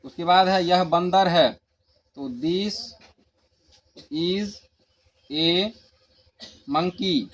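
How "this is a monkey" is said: slow dictation. Shown as a demonstration. Teacher dictating to class.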